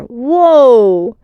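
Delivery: loud startled whoa; surprised whoa. A narrator's reading. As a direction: caught off guard in midair. Swooped up into the air.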